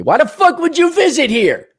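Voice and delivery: usual speaking voice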